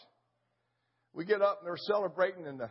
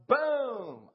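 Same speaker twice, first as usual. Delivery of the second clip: gunshot boom; 7 semitones higher, 6 dB louder than usual. Retold or imitated. Imitating his rifle shot.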